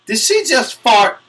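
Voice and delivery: scandalized gossip at the awards show; shocked disbelief. Retold or imitated. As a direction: squeal "pass gas?" in disbelief. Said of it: "I was like".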